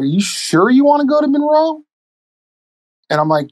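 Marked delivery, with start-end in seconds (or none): 0.0-1.8 lightly voiced skeptical lady, pitched up high; a hint of a wary official lady, higher; doubtful counselor. Counselor questioning the inmate's choice, mock doubtful lady voice. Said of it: "She's like".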